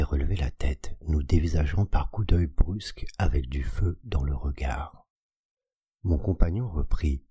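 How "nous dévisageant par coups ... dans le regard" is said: ordinary delivery, nothing marked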